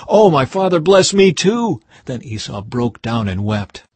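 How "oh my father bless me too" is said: anguished higher begging; pleading. Acted. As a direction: beg the father through tears. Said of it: "Esau pleaded".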